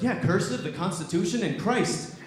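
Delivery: lightly voiced indignant dad; upset dad. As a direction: list them off like an outraged father. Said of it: "and he was upset. He was mad".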